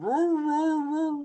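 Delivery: high loud mock hound bay. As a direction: howl like a baying hound. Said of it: "They're like".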